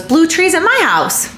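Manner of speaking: put-on high little-kid voice; toddler voice. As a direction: imitate a small child, high. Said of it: "She pointed at the ones at our house and goes".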